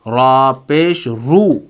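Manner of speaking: very slow "रा पेश रू", R kept in "रू"; letter-sound demo. Shown as a demonstration. Teacher showing the letter keeps its sound, very slow "रा पेश रू". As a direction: stretch "रा पेश", then a long "रू". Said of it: "जो हर्फ है उसी की आवाज़ निकलेगी".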